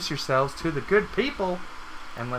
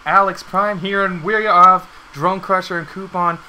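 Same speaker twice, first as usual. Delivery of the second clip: loud, high hype opener; hype intro; 4 semitones higher, 8 dB louder than usual. Acted. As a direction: pump up the crowd like a host.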